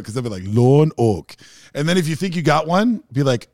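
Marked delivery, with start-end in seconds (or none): 0.4-1.3 loud, mock Minnesotan accent. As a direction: lean into exaggerated Minnesotan vowels. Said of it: "you will find every single Minnesota".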